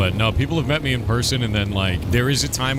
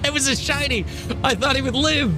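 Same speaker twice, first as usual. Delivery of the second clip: much higher, the real voice slipping through; voice breaking. Real, not acted. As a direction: let the voice crack up high mid-rant. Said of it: "when it breaks".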